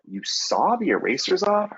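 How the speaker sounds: imitating a stunned teacher; playful disbelief; incredulous question. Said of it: "people are like".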